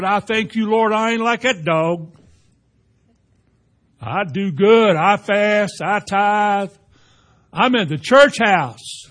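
proud Pharisee. Retold or imitated. Preacher voicing the boasting Pharisee, loud and smug. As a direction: brag to God like a smug Pharisee. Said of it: "The Pharisee said".

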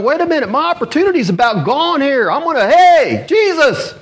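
high, urgent plea building to a shouted hail. Retold or imitated. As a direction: call out desperately over those hushing you. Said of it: "he's crying ... he just got louder".

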